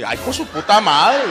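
loud, drawn-out exclamation of alarm; mock shock. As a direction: react aghast, stretching the curse out. Acted shocked reaction to Grillo's answer.

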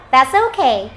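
ordinary talk, nothing marked

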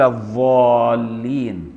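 الضالين drawn out with ظ in place of ض; dha for dad. Shown as a demonstration. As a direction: stretch الضالين slowly with a ظ sound. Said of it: "بالضاء المشالة بدل الضاد".

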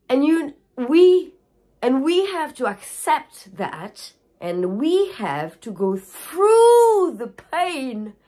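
slow, choked-up, on the edge of crying; real grief breaking through the words; tearful. Real, not acted. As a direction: push the words out through real tears, slowly. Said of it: "the painful part who make me cry".

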